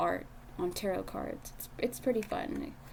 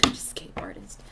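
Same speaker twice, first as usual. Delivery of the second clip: laughing whisper; 12 semitones lower, 3 dB quieter than usual. Real, not acted. Laughing repeat of own phrase.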